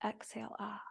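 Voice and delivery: "Exhale" whispered, then a breathy "ah". Shown as a demonstration. Yoga teacher whispering the exhale cue. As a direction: breathe the words out, no voice. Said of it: "take two of these here".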